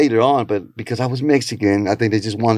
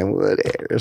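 normal talking voice

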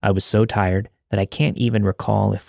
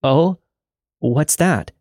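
nervous question, pitched up; 6 semitones higher, slower than usual. Retold or imitated. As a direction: ask it nervously, voice climbing. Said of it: "I nervously asked".

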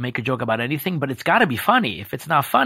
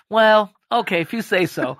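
higher, grudging and unconvinced; doubtful Michael; 7 semitones higher than usual. Retold or imitated. Boss grudgingly accepting his employee's answer, higher and unconvinced. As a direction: let it go reluctantly, still doubting. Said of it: "he's still not convinced".

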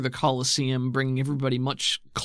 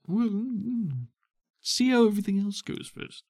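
hesitant Bolt voice; 8 semitones higher than usual. Acted.

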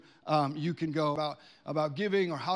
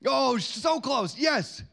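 stretched-out, high, loud cheer; excited encouragement; 7 semitones higher, 6 dB louder, much slower than usual. Real, not acted. Host reacts to kids' near guesses, excited and loud.

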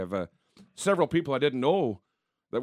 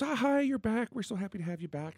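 sweet clerk; 8 semitones higher, 5 dB quieter than usual. Retold or imitated. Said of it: "very sweet, goes".

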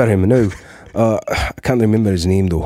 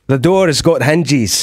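slow Scottish burr on the door has got hinges; Scottish accent, rolling it out slowly; 5 semitones higher, slower than usual. Shown as a demonstration. Doing a Scottish accent for the host, slowly. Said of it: "a bit of the Scottish".

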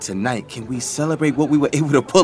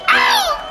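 hype yelp. Acted. Hype yell after the rap.

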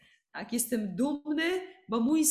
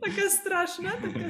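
laughing; 8 semitones higher than usual. Real, not acted. Laughing at her own scary face.

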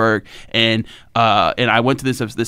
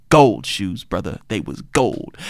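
L C Greenwood; 6 semitones lower, 5 dB quieter, slower than usual. Retold or imitated. Retells legend's cool correction.

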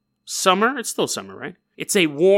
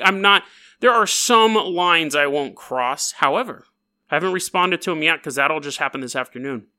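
usual speaking voice

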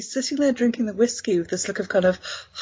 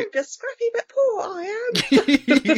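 a much higher, lightly voiced mock Cockney cabin boy; mock Cockney urchin; 13 semitones higher than usual. Co-host lampooning the cabin boy in a high mock Cockney. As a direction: squeak it out like a hopeless stage urchin. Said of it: "artful dodger this kid is not".

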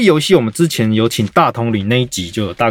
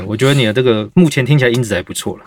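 normal talking voice

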